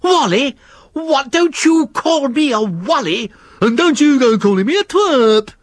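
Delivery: high, indignant squabbling voice; E W the worm outraged at Nifty, indignant high voice. Acted. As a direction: take offence at being called a wally. Worm and slug quarrel.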